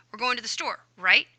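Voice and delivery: statement, then "right" popped up as a question; tag question. Shown as a demonstration. Teacher showing tag words, questioning bump on "right". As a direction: tack a rising, punched "right" onto the end. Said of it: "add that extra bump tag at the end".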